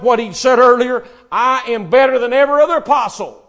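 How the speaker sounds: loud, slowed preacher cry; preaching at full force. Acted. Preacher rises again.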